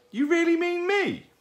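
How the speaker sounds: very high, loud squeak of disbelief; amazed owlet. A narrator's reading. Owlet asked to pose for a picture, amazed. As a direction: squeak it in delighted astonishment.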